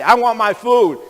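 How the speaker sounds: mock-demanding drive-thru order; playful demand. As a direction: call it out high and playfully. Mimics himself calling at drive-thru.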